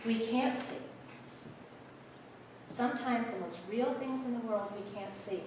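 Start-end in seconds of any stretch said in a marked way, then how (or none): 2.7-5.4 very soft, gentle repeat; hushed. Acted. Conductor sharing wisdom with the boy, hushed. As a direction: drop right down to a near-silent, sincere hush.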